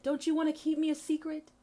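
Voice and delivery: high, lightly mocking plea; mock coaxing. Mimics those who demand secrecy.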